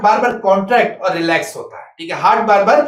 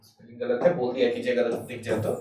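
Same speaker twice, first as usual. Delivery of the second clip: quiet, lower aside about the spelling error; 9 semitones lower, 12 dB quieter than usual. Real, not acted. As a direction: lower and much softer. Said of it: "aside about a spelling mistake".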